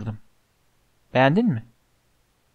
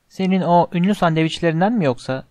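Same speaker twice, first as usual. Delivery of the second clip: ordinary delivery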